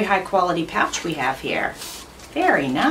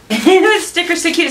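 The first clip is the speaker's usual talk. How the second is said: high, loud gush over something cute; delighted squeal; 10 semitones higher, 7 dB louder than usual. Real, not acted. Vlogger spotting a cute sticker, squealing high and loud.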